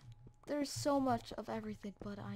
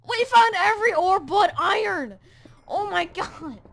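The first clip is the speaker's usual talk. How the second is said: loud exasperated yell; frustrated shout; 5 semitones higher, 14 dB louder than usual. Real, not acted. Gamer yelling about finding every ore but iron, exasperated.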